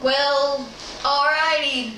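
put-on folksy country accent, drawn out. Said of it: "That's my country accent".

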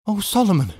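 high, swooping exclamation of recognition; emotional cry. A narrator's reading. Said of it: "cried Miss Pross".

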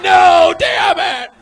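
high, loud exasperated yell; hammed-up shout of frustration; frustrated shout. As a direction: blow up at him in mock anger. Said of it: "I'm like".

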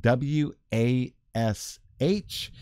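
"W A S H" slowly, letter by letter; spelling it out. Shown as a demonstration. Speaker passing on Connie's correction, slow careful spelling. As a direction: spell "W A S H" with a gap after each letter. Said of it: "Connie reminds me that it is".

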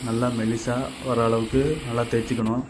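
ordinary delivery

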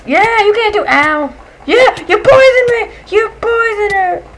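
high, loud indignant shouting; outraged yell. Real, not acted. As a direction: accuse it at full volume. Accusing the enemy of poisoning.

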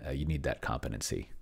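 ordinary delivery

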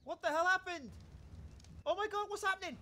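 high, alarmed and confused; panicked. Real, not acted. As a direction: panic as your character collapses. Character suddenly faints.

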